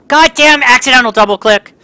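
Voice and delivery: loud, high frustrated yell; real gamer rage at a double click; angry outburst. Real, not acted. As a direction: blow up at the misclick. Frustrated at misclicks.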